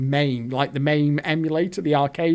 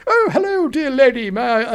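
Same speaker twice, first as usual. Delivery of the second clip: much higher, chirpy mock-English greeting; hammed-up posh English accent; 6 semitones higher, 3 dB louder than usual. To the Scottish barmaid, a hammed-up English accent. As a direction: lay the English accent on thick and sweet. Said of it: "with my English accent".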